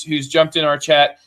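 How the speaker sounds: ordinary talk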